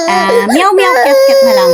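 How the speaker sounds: put-on high, sing-song cat meows for the baby; playful meowing. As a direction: meow brightly to cheer her up. Mother meows to distract baby.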